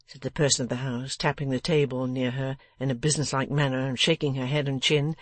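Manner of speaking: usual speaking voice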